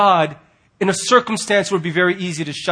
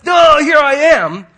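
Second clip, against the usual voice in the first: slow, much louder and higher goofy announcement; mock obvious God; 9 semitones higher, 9 dB louder, slower than usual. Preacher mocking a God jumping in front, loud goofy. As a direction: ham up a God who can't be missed. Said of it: "jump in front and go".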